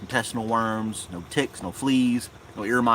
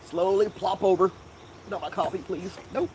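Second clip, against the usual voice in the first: pet talk; 8 semitones higher than usual. Real, not acted. Cooing at dog.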